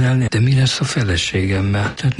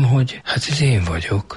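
usual speaking voice

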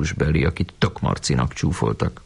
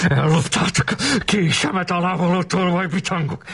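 high-pitched raging yell; acted furious howl; bellowed accusation; 12 semitones higher than usual. A narrator's reading. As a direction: rage at the thieves in a raised pitch. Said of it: "ordította".

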